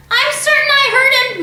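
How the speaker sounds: high, loud insistent cry. A narrator's reading. Matilda crying out that she heard him, high and loud. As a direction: egg the parents on. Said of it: "Matilda cried".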